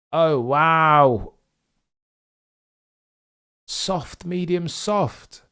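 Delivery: normal talking voice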